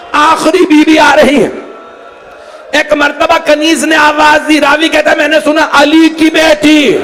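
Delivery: very high, impassioned cry of mourning; wailing majlis narration; full-force lament preaching. A narrator's reading. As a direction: cry the story out to a grieving congregation.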